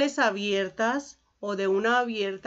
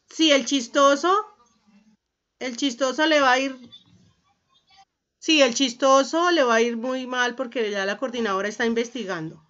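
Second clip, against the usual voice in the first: louder, raised-pitch telling-off; stern warning; 3 semitones higher, 5 dB louder, faster than usual. Real, not acted. Teacher warns the class joker about the coordinator, stern warning. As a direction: warn the prankster firmly, voice raised.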